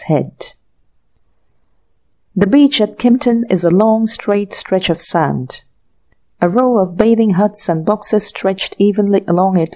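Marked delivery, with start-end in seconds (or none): none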